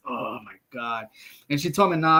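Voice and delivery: ordinary delivery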